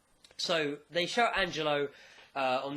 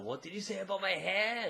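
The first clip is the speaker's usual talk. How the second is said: mild mock anger; 3 semitones higher, 4 dB quieter than usual. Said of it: "a bit pissed off because he's like".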